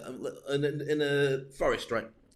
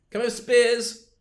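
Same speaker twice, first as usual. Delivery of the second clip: higher, louder call across the forest; mock calling out; 7 semitones higher, 9 dB louder, slower than usual. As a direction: call out to the troops. Calling for spears.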